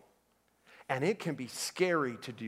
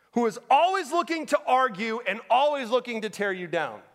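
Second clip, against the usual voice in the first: raised pulpit voice with hard-hit key words; impassioned preaching; 8 semitones higher, 6 dB louder than usual. Real, not acted. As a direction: drive each phrase home, pitch swooping up. Preaches at full force.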